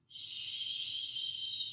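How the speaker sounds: soft sustained shushing exhale; long quiet shh breath. Shown as a demonstration. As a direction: shush out slowly like quieting a baby. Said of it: "your tongue comes up and you go".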